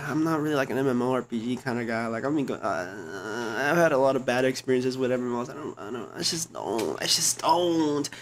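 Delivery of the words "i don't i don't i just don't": ordinary talk, nothing marked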